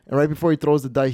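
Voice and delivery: ordinary talk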